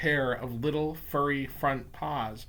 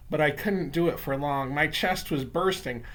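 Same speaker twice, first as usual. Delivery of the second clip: plain reading voice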